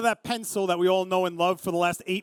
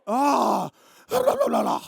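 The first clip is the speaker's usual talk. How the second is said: loud "ah", then high sung la-la-la; hammed-up heavenly choir noise; wordless fanfare for a sudden reveal; mock angelic reveal; 4 semitones higher, 4 dB louder than usual. Mimics camera lighting up.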